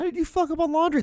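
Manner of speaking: very high, soft incredulous squeak; baffled disbelief. Real, not acted. Comedian baffled at anyone ruining laundry, high-pitched disbelief. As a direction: ask it way up high, astonished.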